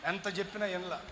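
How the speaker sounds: quiet falling sigh of చెప్పినా; soft repeat. Shown as a demonstration. Preacher echoing himself, soft resigned repeat.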